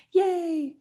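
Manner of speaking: high squeal of mock cheer; squeaky yay. Real, not acted. Cheering wryly about getting over her cold, a high squeaky yay. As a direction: cheer it up high, half joking.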